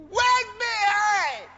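high, slow crying shout; mock wail. Mocking barren women lamenting, slow high wail. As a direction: wail the words out. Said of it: "When they say mothers wait behind".

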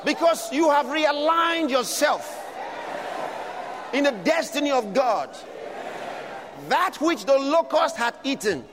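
loud, high, shouted preaching; preaching at full force. Acted. Preacher shouting a promise of restoration to the congregation, at full force. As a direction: thunder the promise over the congregation.

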